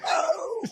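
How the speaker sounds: very high squealed oh; high-pitched "oh". Retold or imitated. Reacting to gear flying everywhere, high-pitched squeal.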